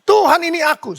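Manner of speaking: high, imploring call; crying out to God. Acted. Preacher voicing a burdened believer's prayer, crying out. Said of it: "mari kita berseru pada Tuhan".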